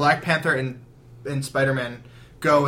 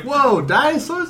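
mock amazement; 11 semitones higher, 5 dB louder than usual. Reporter reacts to dinosaurs.

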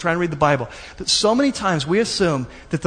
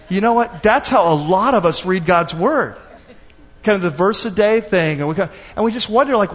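ordinary talk

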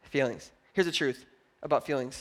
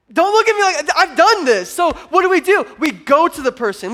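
mock accusing; 10 semitones higher, 10 dB louder than usual. Real, not acted. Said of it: "teasing the congregation".

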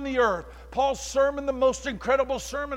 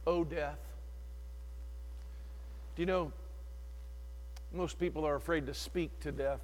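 ordinary delivery

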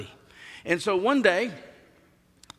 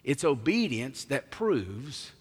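ordinary delivery

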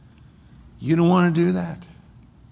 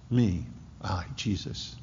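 hushed; 6 semitones lower, 9 dB quieter than usual. Real, not acted. Preacher hushing the congregation on the name Jesus. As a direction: drop almost to a whisper on Jesus.

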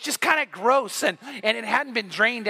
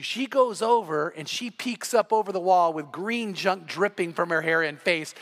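ordinary talk, nothing marked

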